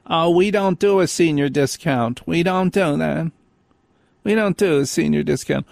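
snooty refusal from the counter; mock clerk. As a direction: mimic a curt counter clerk. Mocking Dunkin' Donuts clerk.

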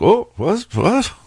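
very high startled squeak; surprised. Retold or imitated. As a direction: jolt up into a giddy high voice. Startled eighteen-year-old in him.

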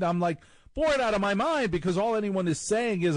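usual speaking voice

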